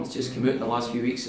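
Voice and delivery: ordinary delivery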